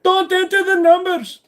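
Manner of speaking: put-on very high, loud panicked shout, Indian accent; hammed-up panic in an Indian accent; freaking-out scammer. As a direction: shriek it as a scammer losing control. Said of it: "when they freak out over that".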